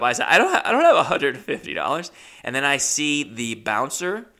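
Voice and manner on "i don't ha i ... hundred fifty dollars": louder, higher, hurried tearful protest; mock choked up. Comic replays pleading broke to the dancer, mock choked up. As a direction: blurt it out as if about to cry. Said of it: "start getting choked up".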